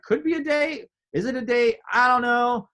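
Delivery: high, noncommittal musing; unsure wondering. Retold or imitated. Man wondering aloud whether dinner is a date, unsure.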